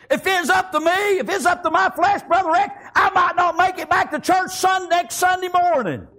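high, driving preacher's delivery; full-force preaching. Real, not acted. Preacher confessing his weak flesh to Brother Rick, full-force preaching.

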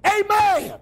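high, hollered amen. Shown as a demonstration. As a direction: let it out boldly, pitched right up. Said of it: "then try a little louder".

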